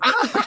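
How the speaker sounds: very high laughing squeal; imitated whole-hearted laugh. Imitating a woman laughing in line, high whole-hearted squeal. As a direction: mimic someone's unrestrained high laugh. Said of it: "she was laughing with her whole spirit".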